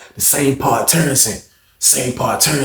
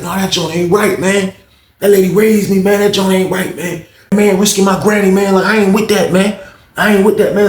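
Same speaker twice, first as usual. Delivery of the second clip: upset; 8 semitones higher, 4 dB louder than usual. Retold or imitated. Grandson crying over his granny.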